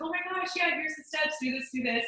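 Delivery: high, excited retold voice; excited friend. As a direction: imitate a thrilled friend rattling off instructions. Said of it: "she was so excited".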